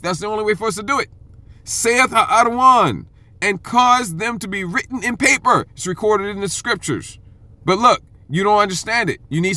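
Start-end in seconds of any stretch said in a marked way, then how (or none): 1.6-5.7 raised, slow proclaiming read; preaching scripture. Acted. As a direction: declare each word to the congregation. Preacher reads scripture aloud.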